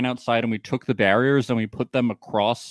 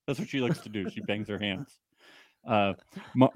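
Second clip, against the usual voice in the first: ordinary delivery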